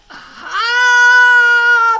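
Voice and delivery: huge, high, loud dragon roar; a giant beast's howl of irritation; monster bellow. A narrator's reading. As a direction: bellow like a tickled sea monster. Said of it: "bellowed the sea dragon".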